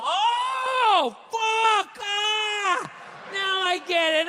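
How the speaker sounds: hammed-up eureka shout; mock sudden realization. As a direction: cry out as the penny drops.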